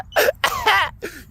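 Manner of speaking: very high, loud laugh; gloating cackle. Retold or imitated. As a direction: cackle triumphantly in the devil's face. Gloats at the devil.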